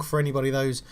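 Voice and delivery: ordinary talk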